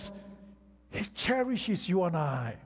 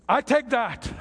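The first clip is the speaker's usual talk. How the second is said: emphatic preaching; 6 semitones higher, 7 dB louder than usual. Acted. Preaching, repeated line.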